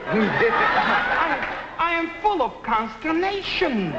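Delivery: high, laughing mock outrage; can't keep a straight face on the last big word; amused theatrical consternation; laughing through it. Shown as a demonstration. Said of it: "big English words".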